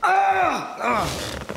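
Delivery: startled scream. Acted. Startled in dark flat.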